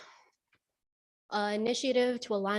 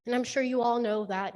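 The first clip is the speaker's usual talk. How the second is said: ordinary talk, nothing marked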